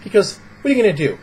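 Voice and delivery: usual speaking voice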